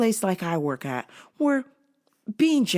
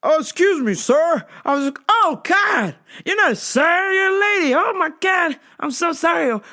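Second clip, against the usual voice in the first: high, loud mock apology; hammed-up gushing embarrassment, pitched up; flustered customer; 6 semitones higher, 4 dB louder than usual. Customer apologizing for calling her sir, mock flustered.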